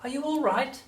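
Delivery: high, polite grandson voice; polite asking. A narrator's reading. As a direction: ask sweetly, pitched well up. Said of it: "George asked her politely".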